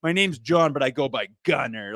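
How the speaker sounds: sneering, mocking imitation of a smug kid. Host mocks the player's self-chosen nickname, sneering. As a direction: scoff it out like a smug brat, laughing.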